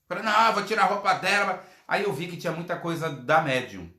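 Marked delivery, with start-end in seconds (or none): 0.2-1.6 defiant spirit. Retold or imitated. Spirit defies the speaker.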